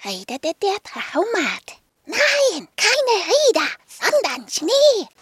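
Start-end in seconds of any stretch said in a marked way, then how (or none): 2.0-5.1 higher, louder, slower at the end; calling out. Acted. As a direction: insist loudly on snow. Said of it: "rief Rüdiger".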